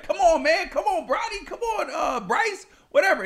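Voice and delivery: high-pitched mock coaxing of reluctant kids; LeBron James. Famous dad to his kids on a live video, coaxing. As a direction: plead like a dad being turned down. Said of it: "And he was like".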